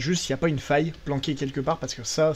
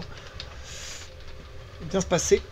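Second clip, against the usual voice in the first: usual speaking voice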